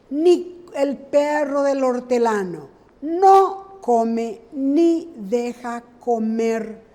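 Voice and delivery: slow saying with "ni" leaned on hard; stressed example. Shown as a demonstration. Teacher stressing "ni" in a proverb for the class. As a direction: drag the proverb out, hitting each "ni". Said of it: "Y ni usamos para".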